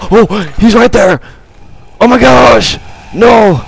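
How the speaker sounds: high, loud yell of panic; terrified yell. Real, not acted. Player seeing the zombie right there, terrified yell. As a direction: yell it out in fright.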